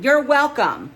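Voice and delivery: loud, high and pleased with herself; smug greeting. Acted. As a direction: announce it like a triumph. Rennie flings the door open.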